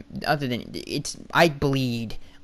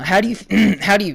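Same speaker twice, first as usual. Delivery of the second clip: strained voice, catching and breaking off; losing voice; 7 semitones higher, 6 dB louder than usual. Real, not acted. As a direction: voice giving out, clear the throat. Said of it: "God, I'm losing my voice".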